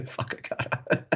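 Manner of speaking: breathy, slowed whisper between laughs; mock-spiteful whisper, slow; half-whispered jab. Real, not acted.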